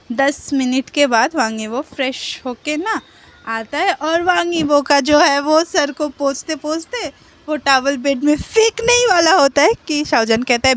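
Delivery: usual speaking voice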